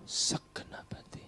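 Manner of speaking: slow hushed whisper; soft unvoiced devotion. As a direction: breathe it gently. Whispered phrase.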